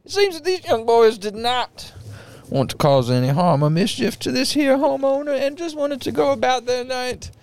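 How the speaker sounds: bang the gavel like a Southern judge; Southern judge accent. Acted. Judge calling order and giving his ruling, Southern accent. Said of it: "I couldn't talk in that accent".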